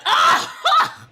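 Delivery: high, loud mock guffaw; bar-slapping outburst. Drunk customer slapping the bar at a joke, loud outburst. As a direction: let out one big shouted "ah". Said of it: "slaps the bar like".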